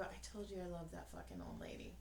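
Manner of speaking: fast whispered aside. Retold or imitated. Mother whispering to son in line, fast whisper. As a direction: whisper it quickly to your son.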